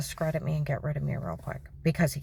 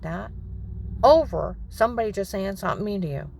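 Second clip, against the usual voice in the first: higher, louder dad building to a yell; angry dad scolding; 5 semitones higher, 4 dB louder than usual. Retold or imitated. Father going off on his crying daughter, building scold. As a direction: scold the kid harder and harder. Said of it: "He's like".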